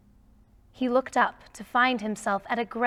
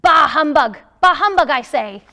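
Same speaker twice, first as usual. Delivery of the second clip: loud, high grumpy shout of bah humbug; 5 semitones higher, 12 dB louder than usual. Acted. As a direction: bark it out at the well-wishers. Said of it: "then shout".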